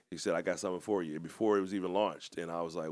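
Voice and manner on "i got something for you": ordinary talk, nothing marked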